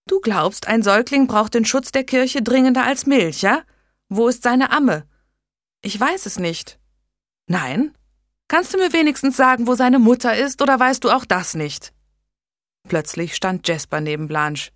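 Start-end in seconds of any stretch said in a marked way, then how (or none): 0.1-11.9 raised, louder, biting questions; angry scolding grandfather. A narrator's reading. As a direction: tear into her for neglecting the baby. Old Tudor berates Blanche.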